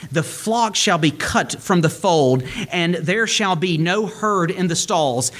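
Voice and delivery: scripture declaimed high and fervent; preaching. Acted. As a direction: proclaim each line up high like a preacher. Scripture read in raised voice.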